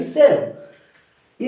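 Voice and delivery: सेल stretched very slowly; long, slow final सेल; drawn out. Shown as a demonstration. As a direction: hold the word सेल out long. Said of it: "teacher drawing out the word".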